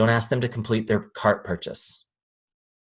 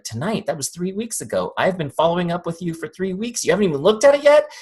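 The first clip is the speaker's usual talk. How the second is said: high, incredulous outburst building louder; exasperated disbelief; 8 semitones higher, 3 dB louder than usual. Acted. Salesperson to client after three weeks of follow-up, exasperated. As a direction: act out being driven nuts, voice climbing. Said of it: "this is the part that drives me nuts".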